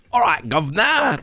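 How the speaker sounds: high, hammed-up Cockney greeting; overdone fake London accent; mock Cockney accent. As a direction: parody a tourist's bad Cockney. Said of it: "don't move here and be like".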